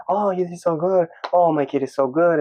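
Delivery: high, cheering grandma voice. Retold or imitated. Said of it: "She's like".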